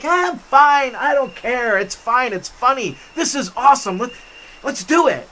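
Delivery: high-pitched, carefree retold bravado; breezy bravado. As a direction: brush off the danger, all pumped up. Bravado against friends' panic.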